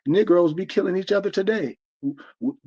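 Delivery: usual speaking voice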